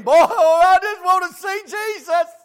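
high, loud, slow pleading voice of a churchgoer; longing churchgoer. Retold or imitated. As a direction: plead slowly, reaching up high. Man in the crowd longing.

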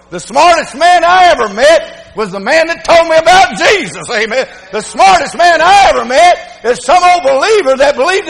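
loud, high-pitched sermon delivery; full-force preaching. Real, not acted. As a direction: preach it hard and loud to the congregation.

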